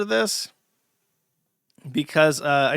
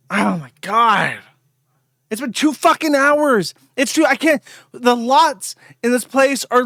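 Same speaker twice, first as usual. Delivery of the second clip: loud and higher, exasperated yelling; furious rant; 8 semitones higher, 7 dB louder than usual. Real, not acted. Streamer ranting to chat about a neighbour's mowing, furious shouting. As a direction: yell out the frustration of endless noise.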